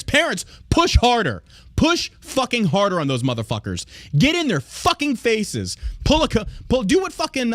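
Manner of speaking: angry urging. Real, not acted. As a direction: fire up the parents, voice rising. Host urging parents to fight back.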